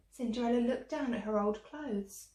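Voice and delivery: ordinary delivery, nothing marked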